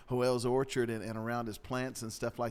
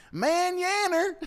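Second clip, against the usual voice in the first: high, drawn-out East Texas holler; East Texas yell; 7 dB louder, much slower than usual. Retold or imitated. Man botches Spanish goodbye to orphanage director, East Texas yell. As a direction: yell it slow in thick East Texas twang. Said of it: "yells out in his deepest East Texas voice".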